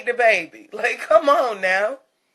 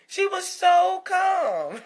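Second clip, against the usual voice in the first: slow dreamy calm; 8 semitones higher, much slower than usual. Acted. Dreamy description of calm baby.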